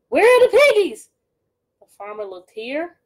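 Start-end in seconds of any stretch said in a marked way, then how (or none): 0.1-1.1 much higher and louder, insisting; shrill demand. Acted. Gritch demanding the piggies, high and loud. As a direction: demand it like a hungry witch.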